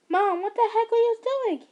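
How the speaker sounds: high, loud furious yell. Acted. Son yelling at mother after the tackle. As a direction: shout the question at her. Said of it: "he yelled out".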